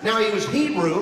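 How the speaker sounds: ordinary delivery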